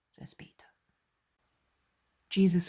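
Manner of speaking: ordinary delivery, nothing marked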